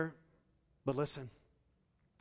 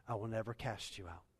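softer, lower, settled; gentle promise; 3 semitones lower, 6 dB quieter than usual. Acted. Pastor voicing Jesus reassuring his child, soft promise. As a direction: say it close and tender.

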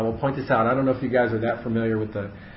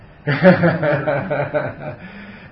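laugh; 3 semitones higher, 5 dB louder than usual. Real, not acted. Laughing at the gnosis news pun.

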